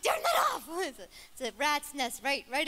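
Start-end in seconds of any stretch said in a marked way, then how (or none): 0.0-0.7 high, loud comic yell; mock alarm. Preacher joking about her messy hair, mock alarmed yell. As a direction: yelp it as a joking order.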